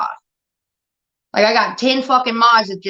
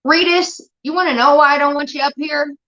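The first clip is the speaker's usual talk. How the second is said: raised, higher, sharp callout at a chatter; angry callout; 7 semitones higher than usual. Real, not acted. Streamer angrily calling out a chatter by name. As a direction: tell him off to his face.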